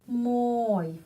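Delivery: normal talking voice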